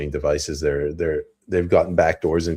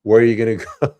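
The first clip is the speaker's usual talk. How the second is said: higher and louder, laughing through the taunt; mocking laugh breaking into the question; 4 semitones higher, 7 dB louder, much faster than usual. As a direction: chuckle as you sneer where he'll go. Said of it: "they'll be like".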